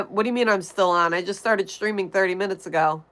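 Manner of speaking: usual speaking voice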